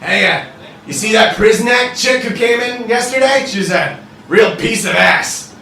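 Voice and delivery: brash devil officer, chatty. Acted. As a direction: talk up a stranger at the bar, loudly.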